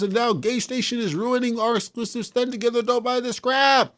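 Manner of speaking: high-pitched mock of a pissed-off gamer; mocking whiny fan. Reading another fan's angry comment aloud in a high, mocking voice. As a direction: sneer it out as a silly fan.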